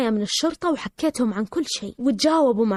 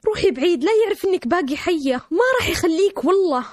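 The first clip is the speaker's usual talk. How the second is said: raised, anxious motherly plea; urgent pleading; 6 semitones higher than usual. Retold or imitated. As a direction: beg her to get away, voice pitched up. Mother begs daughter to run away.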